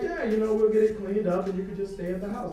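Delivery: mock wife invitation. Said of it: "She was like".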